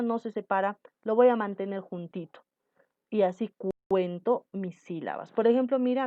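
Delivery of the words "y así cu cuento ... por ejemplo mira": normal talking voice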